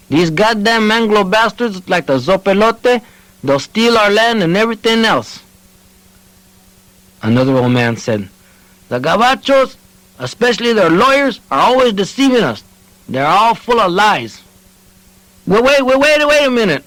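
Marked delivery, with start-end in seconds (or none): 0.1-5.4 put-on nervous old man, higher; a nervous croaking outburst. A narrator's reading. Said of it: "nervously croaked". 8.9-14.4 put-on high, indignant old voice; bitter old man. A narrator's reading. Another old man complains. 15.5-16.8 flustered, much higher and louder; stammering. A narrator's reading. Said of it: "Onofre Martinez stammered".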